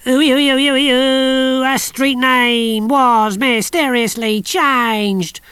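high, slow sarcastic ghost-story wail; mock spooky voice. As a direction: ham up the spooky mystery. Big Mac mocks the street mystery.

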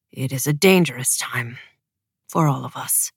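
low, quiet commander's voice; grave father. A narrator's reading. Commander warning his family of danger, low and grave. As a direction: drop low and soft, cautioning. Broom replies, gravely thawing.

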